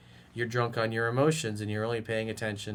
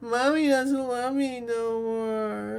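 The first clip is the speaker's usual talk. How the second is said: much higher, slow crybaby voice; whiny little kid; 10 semitones higher, much slower than usual. Pretend. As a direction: whine it like a sulking toddler. Mocking him as whiny child.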